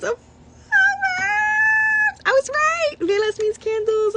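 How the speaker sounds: high, gleeful burst; thrilled to be right, voice jumps up; excited. Real, not acted. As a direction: squeal of delight. Reader delighted at guessing a word.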